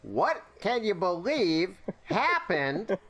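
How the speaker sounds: high, very slow drawn-out mimicry; David Lynch. Player riffing on the director's way of talking, David Lynch voice. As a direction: stretch each word with odd pauses. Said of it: "he talks like David Lynch".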